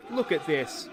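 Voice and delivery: sung out with delight; gleeful chant; excited sing-song. Real, not acted. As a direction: sing it out at the replay. Watching the replay.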